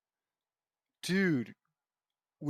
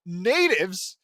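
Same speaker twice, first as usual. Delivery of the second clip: incredulous; 3 semitones higher, 9 dB louder than usual. Real, not acted. Outraged at the word.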